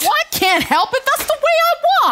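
high, fast defensive whine; protesting how she walks. Acted. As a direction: whine back in self-defence.

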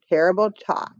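ordinary delivery, nothing marked

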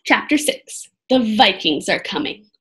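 plain reading voice

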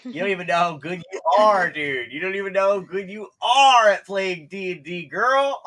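loud, high, hyped-up praise; excited shouting praise. Real, not acted. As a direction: yell the compliment with delight. Host praising player, builds up.

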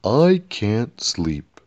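very slow, drawn-out moan; moaning king. A narrator's reading. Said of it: "moaned the king".

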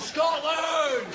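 very high, screamed war cry; defiant battle cry. Acted. Scot joining companion against a lift, screamed battle cry. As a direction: scream the country's name in defiance.

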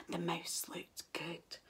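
hushed, slow sly whisper. A narrator's reading. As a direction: whisper it like a secret. Narrator whispers snake's thought.

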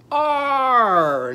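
high, loud drawn-out "arr"; demonstrated "arr" sound. Shown as a demonstration. Teacher repeats Arnie's sound for children, long loud arr. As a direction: hold the arr, then let it slide down. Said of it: "Let's do that again".

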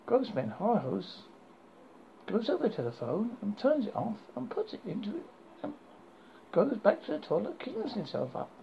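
plain reading voice

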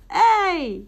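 sudden high excited yelp; excited shout. Real, not acted. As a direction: cry out at a near win. Near win, exclaims.

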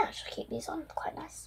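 fast, hushed whisper. Real, not acted. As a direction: whisper it to yourself. Quietly trying glasses on.

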